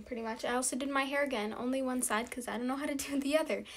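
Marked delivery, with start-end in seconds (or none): none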